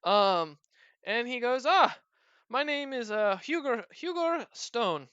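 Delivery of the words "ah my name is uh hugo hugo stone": high bright greeting, slowing on the name; proud dwarf. Acted. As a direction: introduce yourself grandly, drawing out the name. GM voices dwarf Hugo.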